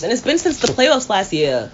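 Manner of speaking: usual speaking voice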